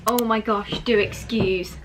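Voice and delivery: ordinary delivery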